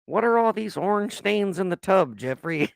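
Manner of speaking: lightly voiced old granny; hint of a nagging grandma. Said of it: "say this in the grandma voice".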